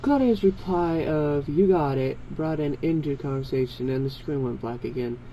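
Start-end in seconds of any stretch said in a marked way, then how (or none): none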